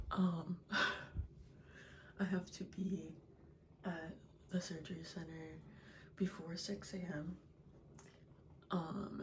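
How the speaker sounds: early morning hushed whisper. Real, not acted. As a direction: whisper so no one wakes. 4:30am before surgery, whispering.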